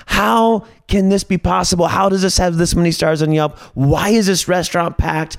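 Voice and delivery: high-pitched, incredulous complaint; exasperated disbelief. Retold or imitated. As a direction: protest each question in baffled exasperation. Acting out disbelief at bad restaurant.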